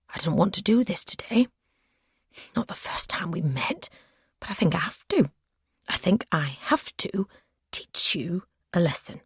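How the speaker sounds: unvoiced, menacing whisper; soft, chilling whisper of a possessive captor; creepy captor's whisper. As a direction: breathe it like a threat.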